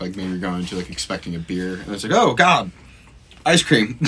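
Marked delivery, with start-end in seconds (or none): 2.1-4.0 mock shock. Mock shock at sweet beer.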